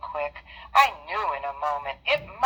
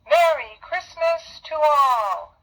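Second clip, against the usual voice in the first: higher, louder, slow festive call; jolly exclamation; 7 semitones higher, 4 dB louder, slower than usual. Acted. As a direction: ring it out as he flies off. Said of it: "I heard him exclaim".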